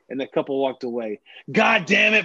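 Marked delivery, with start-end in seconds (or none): 1.5-2.2 high, loud mock tirade; exasperated outburst. Coach blowing up at his helper over a pen, exasperated. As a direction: blow up like a fed-up coach. Coach blows up after fans leave.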